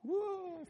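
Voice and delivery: high sliding woo as if falling. Shown as a demonstration. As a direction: yelp like slipping off. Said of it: "If I were to literally fall off".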